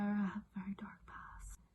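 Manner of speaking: hushed, nearly whispered joke confession; mock-dark confession. Confessing to viewers about the people battered, mock-dark whisper. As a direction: whisper it like a guilty secret.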